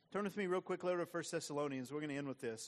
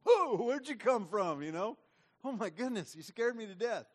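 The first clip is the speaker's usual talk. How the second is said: startled disciples; 6 semitones higher, 8 dB louder than usual. Retold or imitated. Disciples startled by the angels, shouting in mock fright. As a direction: jump at the strangers suddenly there.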